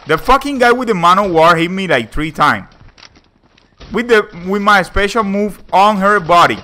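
loud, high frustrated rant; angry rant. Real, not acted. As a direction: vent it loudly, fed up. Ranting after being killed.

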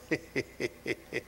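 quiet breathy snicker; stifled, mocking chuckle. Acts Elijah snickering at the prophets.

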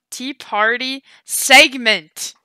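loud, high, drawn-out announcement; playful big-intro voice; segment announcer. Pretend. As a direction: belt out the segment title.

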